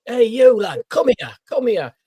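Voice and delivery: calling out high and loud across a room; old man hollering for someone to come over; retold shout. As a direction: summon him from across the ward. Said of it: "he shouted across".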